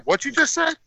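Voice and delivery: high, shocked mother's question; shocked mom. Retold or imitated. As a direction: ask it as a stunned mother.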